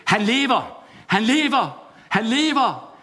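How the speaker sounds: triumphant preacher's cry; proclaiming. Acted. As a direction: announce it to the streets like a herald. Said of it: "begyndte at forkynde det".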